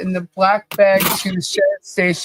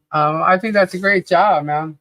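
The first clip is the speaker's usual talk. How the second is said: ordinary delivery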